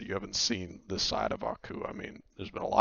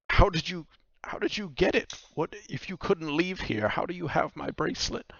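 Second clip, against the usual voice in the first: high-pitched, insistent woman; agitated questioning, pitch well up; questioning Vaku; 10 semitones higher, 3 dB louder than usual. Acted. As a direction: demand an answer in disbelief. Vaku challenges the nightmare.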